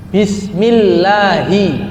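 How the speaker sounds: "Bismillahi" slow, doubled "ll" held; clear tasydid. Shown as a demonstration. Teacher modelling recitation, double l held. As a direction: recite it slowly, stressing the double l. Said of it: "Harus dibaca tasdidnya, jelaskan".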